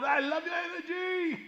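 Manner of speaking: high, slow, starry-eyed fan voice; gushing young fan. Retold or imitated. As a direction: gush like a fan meeting his hero.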